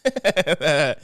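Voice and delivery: real amused cackle; laughing. Real, not acted. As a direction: burst of loud laughter. Laughs after her own rant.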